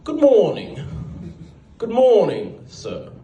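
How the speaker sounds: high, hammed-up British greeting; British accent slipping out. Coworker's secret Britishness slipping out, exaggerated British accent. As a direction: let the British accent burst out. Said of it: "waiting one day for it to slip out".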